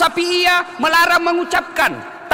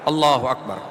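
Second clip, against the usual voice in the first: mock low takbir; 13 semitones lower than usual. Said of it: "Yang penting jangan kau pakai semua".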